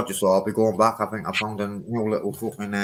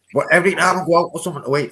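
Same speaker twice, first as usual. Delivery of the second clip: excited rush; 8 semitones higher, 8 dB louder, faster than usual. Real, not acted. Excited rant about ordering steak.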